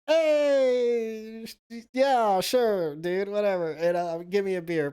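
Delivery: high, loud mock tipsy brush-off; tipsy himself. Speaker at the bar brushes off the stranger, high loud tipsy voice. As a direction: wave him off, just wanting a beer. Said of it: "And I'm like".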